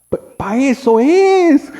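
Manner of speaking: high, loud, drawn-out protest; exasperated outburst. Real, not acted. Preacher protests at an unused Bible, exasperated high cry. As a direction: cry it out slowly, stretching the end.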